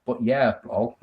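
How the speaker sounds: a dry, low, teasing repeat of the sign-off; teasing mock echo. Teasing a viewer about his sign-off, mock dry repeat. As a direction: echo their words back to poke fun. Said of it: "I'm teasing".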